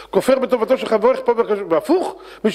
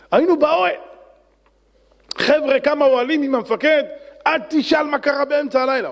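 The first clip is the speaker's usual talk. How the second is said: higher, excited driver voice; eager taxi driver; 7 semitones higher than usual. Acted. Driver eagerly tells the rabbi his army story, higher voice. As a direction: blurt it out like a keen cabbie.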